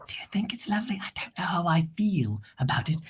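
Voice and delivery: hushed unvoiced whisper; secretive whisper to viewers; whispered aside. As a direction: lean in and whisper doubts.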